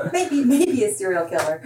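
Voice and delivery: laughing through a dark joke. Real, not acted. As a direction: chuckle as you say it. Laughs at her own joke.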